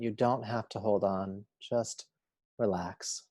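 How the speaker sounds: measured yoga nidra guide voice; unhurried, steady meditation instruction; guided meditation. Acted. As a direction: guide them calmly, a phrase at a time with long pauses.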